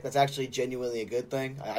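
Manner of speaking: normal talking voice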